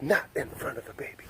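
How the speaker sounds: put-on softly, as if shielding a baby's ears; imitating a friend's hushed shock; mock hushed protest. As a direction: hush it like covering the dog's ears. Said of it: "So she's like".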